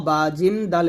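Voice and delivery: ordinary talk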